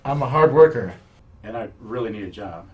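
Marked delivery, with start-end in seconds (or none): 0.0-0.9 eager shout. Acted. Job interview, pleading eagerly.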